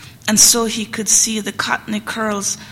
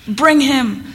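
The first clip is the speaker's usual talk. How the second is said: sharp, louder, higher command; 4 semitones higher, 5 dB louder than usual. A narrator's reading. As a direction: snap out the order. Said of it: "Miss Cora again with a sharp voice".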